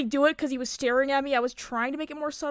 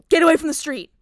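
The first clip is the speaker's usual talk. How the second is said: put-on loud sharp yell at a child; louder mock parent shout; 5 semitones higher, 10 dB louder, faster than usual. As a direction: snap at a kid near the road. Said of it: "when you yell at a small child".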